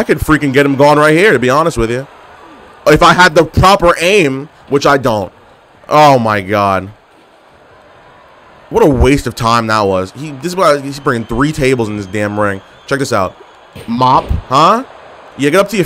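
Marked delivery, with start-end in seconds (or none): none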